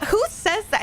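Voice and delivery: high-pitched disbelieving question; incredulous. Retold or imitated. Incredulous reaction to his odd phrase.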